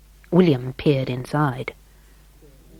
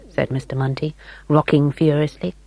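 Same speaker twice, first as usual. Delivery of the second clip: plain reading voice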